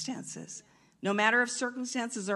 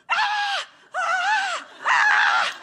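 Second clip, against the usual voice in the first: very high, loud repeated scream; long mock scream; 9 dB louder, much slower than usual. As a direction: scream out in full fright. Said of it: "but this was like".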